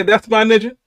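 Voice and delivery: high, loud, rising amazed yell; can't believe he said it; excited disbelief. Real, not acted. Quoting the lyric in disbelief.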